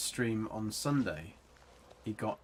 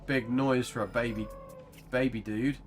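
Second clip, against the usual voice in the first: ordinary talk, nothing marked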